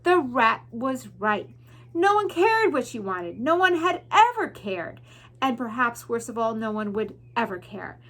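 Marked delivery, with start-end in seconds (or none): none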